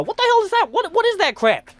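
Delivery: can't believe what he just heard; scoffing at the blood gun quota; incredulous disbelief. Real, not acted. Outraged at the studio's quota.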